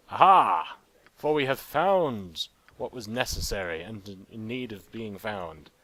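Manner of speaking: booming mock-heroic announcement, then slowing; triumphant proclamation. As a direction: proclaim the find like a grand hero.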